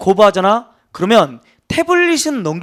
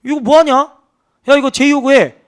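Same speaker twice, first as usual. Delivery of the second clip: irritated order; 6 semitones higher, 3 dB louder than usual. Retold or imitated. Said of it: "이렇게 얘기한 거예요".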